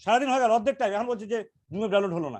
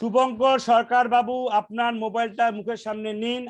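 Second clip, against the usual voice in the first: slow and clear; slower than usual. Real, not acted. Caller's line unclear, speaks slowly.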